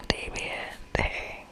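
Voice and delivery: normal talking voice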